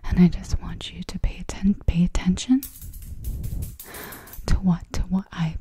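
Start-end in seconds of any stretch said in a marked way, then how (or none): none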